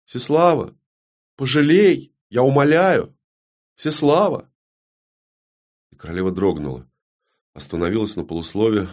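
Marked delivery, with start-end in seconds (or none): 0.1-4.5 high, imploring cries; begging. Acted. Далина begs Всеслава for mercy, imploring. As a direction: implore her, phrase by separate phrase. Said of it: "неожиданно попросила Далина".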